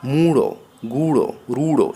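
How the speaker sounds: long first vowel in মূঢ়, গুড়, রূঢ়. Shown as a demonstration. Teacher showing long ঊ, drawn out in মূঢ়, গুড়, রূঢ়. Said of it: "দীর্ঘ ই উচ্চারণ হয়".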